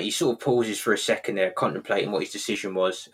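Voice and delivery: ordinary talk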